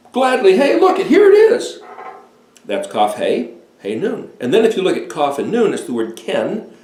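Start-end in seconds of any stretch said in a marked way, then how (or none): 0.1-1.8 much higher and louder, eager discovery; gleeful announcing here it is; excited exclamation. Pretend. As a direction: cry out like finding treasure. Acting out the word's meaning.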